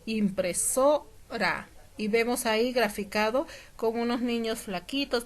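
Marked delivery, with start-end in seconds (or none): 0.0-1.7 "impresora" with SO raised and held, a break before ra; teacher isolating the stressed SO of "impresora". Shown as a demonstration. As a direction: lean on SO in "impresora", then drop on ra. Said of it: "la sílaba tónica también en la palabra".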